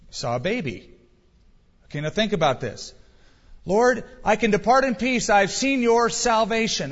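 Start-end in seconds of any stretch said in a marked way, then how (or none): 3.7-6.9 voicing Simeon. Simeon voiced in retelling.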